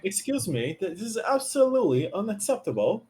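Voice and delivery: mock British complaint; British complaining. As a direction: complain like a put-out Brit. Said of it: "how to do a British accent".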